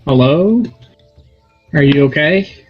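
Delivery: loud call into the ring. Acted. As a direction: shout hello down the ring. Shouting into the ring.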